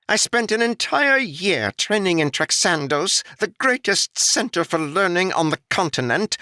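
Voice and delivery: high, haughty, put-upon nobleman's voice; a pampered lord griping about his posting; annoyed noble. Acted. Noble complaining to the old foreman, high annoyed haughty voice. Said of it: "complaining in an annoyed voice".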